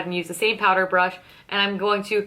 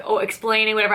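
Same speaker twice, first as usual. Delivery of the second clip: ordinary talk, nothing marked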